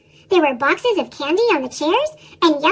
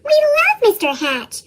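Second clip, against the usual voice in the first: insisting; 7 semitones higher than usual. A narrator's reading. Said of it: "insisted Mister and Missus Dunwoody".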